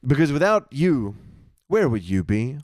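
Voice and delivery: mock announcer.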